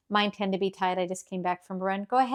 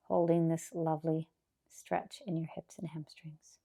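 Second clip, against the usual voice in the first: whispering; 3 semitones lower, 9 dB quieter than usual. Acted. Yoga cue during stretch.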